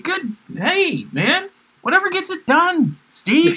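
very high mock-encouraging voice; falsetto cheer. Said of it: "I was like".

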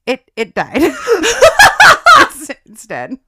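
breaking the news while cracking up; slow, giggling delivery of bad news; laughing through it. Telling the guard his Tamagotchi died, laughing.

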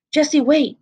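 ordinary delivery

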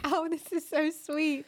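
looking back on the surprise gift, slow and fondly touched; touched gushing. Retold or imitated. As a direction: replay the moment you were touched, stretched out and sweet. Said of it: "I was like".

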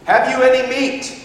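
high, sing-out call from afar; annoying stranger calling. Retold or imitated. Somebody on the shore calling to fishermen, annoyingly. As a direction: call it across the water, high-pitched. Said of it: "he hears this annoying statement".

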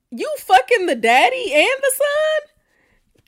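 high, loud and slow disbelief; scandalised. Real, not acted. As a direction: shriek it, scandalised.

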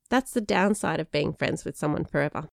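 ordinary delivery, nothing marked

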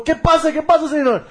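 rushed worried waiter impression; imitating Mexican waiter. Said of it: "ele chegou do meu lado e falou".

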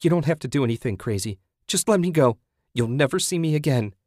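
high, hurried begging; desperate pleading. A narrator's reading. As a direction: beg a captor to let you go. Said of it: "Susan pleaded".

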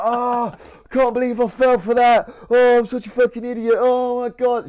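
high, loud, self-mocking wail of embarrassment; mortified groan. Mikey realising the queue tricked him, high loud embarrassed wail. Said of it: "Mikey's like".

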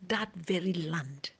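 slow, hushed whisper; whispered emphasis. Real, not acted. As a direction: lean in and whisper it slowly.

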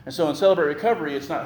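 ordinary talk

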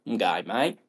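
brief Aussie accent demo, rising end; Australian accent. Shown as a demonstration. Host challenging co-host, demonstrates an Australian accent. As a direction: show how an Australian would say it. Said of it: "can you even do an Australian accent".

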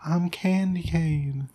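put-on breathy whispered girly act, slow; a guy faking a girl on the CB radio; fake girl voice. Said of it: "they would get on the C B radio and be like".

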